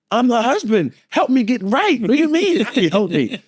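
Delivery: high-pitched, hammed-up exasperation; mock-frantic husband. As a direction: demand help in a high panicked voice. Frantic husband snaps at desk lady.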